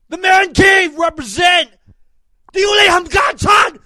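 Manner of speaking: sustained high, loud announcer shouting; hype-man shouting. Acted. Host closing the show with wild hype shouts, high and loud.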